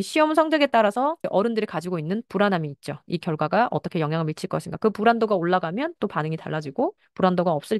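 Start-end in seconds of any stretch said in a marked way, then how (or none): none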